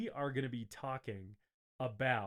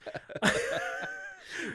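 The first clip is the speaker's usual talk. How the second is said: high squealing laugh; burst of laughter. Real, not acted. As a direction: cracks up at the joke. Big laugh after a dirty joke.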